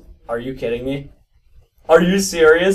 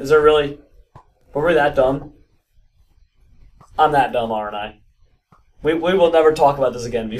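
usual speaking voice

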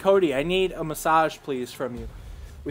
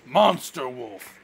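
monster voice; 3 semitones lower, much slower than usual. Pretend. Slow monster voice joke.

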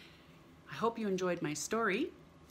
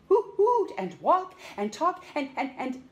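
louder, faster list climbing up; building excitement; 6 semitones higher, 8 dB louder than usual. A narrator's reading. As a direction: pile it on, excited.

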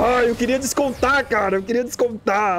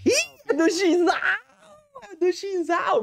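slow, higher delighted shout; excited reveal; 8 semitones higher, much slower than usual. Real, not acted. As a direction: cheer the winner's name. MVP reveal to his teammate.

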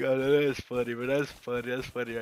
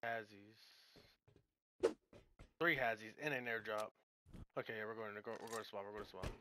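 quiet; 17 dB quieter, faster than usual. Real, not acted. Quietly sneaking in game.

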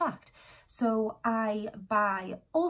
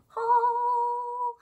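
high, loud sung-out word; excited; 5 dB louder than usual. Acted. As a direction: belt it out with glee. Announcing the haul excitedly.

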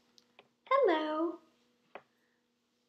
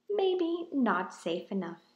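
ordinary delivery

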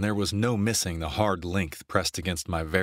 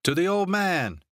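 put-on rousing drunken toast; 12 semitones higher than usual. A narrator's reading. Tipsy brother toasting their late father, a rousing high toast. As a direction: raise a glass with a high, ringing call. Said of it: "lifted his glass a little too roughly".

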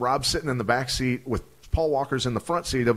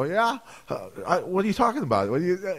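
feigned innocence; 8 semitones higher than usual. Retold or imitated. Playing dumb with the accountant.

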